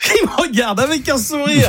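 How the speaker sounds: high, laughing protest; laughing as you call him out; amused teasing. Real, not acted. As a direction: tease him through a laugh. Teasing guest about his smile.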